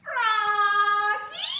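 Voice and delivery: long loud high call; mother calling Froggy loudly and excitedly. Acted. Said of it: "soft voice or a loud voice? Loud".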